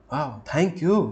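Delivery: rising, impressed thank you; delighted thanks. Shown as a demonstration. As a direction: thank them with a wow. Delighted take in tone demo. (खुशी से चहकता धन्यवाद)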